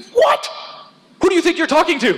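very high, loud, affronted squawk; mock indignation. Offended Pharisee answering John the Baptist, mock indignant. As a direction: act outraged and offended, pitch shooting up.

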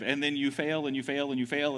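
normal talking voice

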